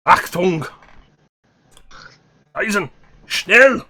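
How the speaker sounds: German barking. Acted. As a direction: bark German orders. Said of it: "You could try German".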